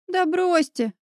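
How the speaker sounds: high, dismissive snort; snorting dismissal. A narrator's reading. Olya waves off the neighbour's apology, high snort. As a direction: brush it off with a snort. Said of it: "фыркнула Оля".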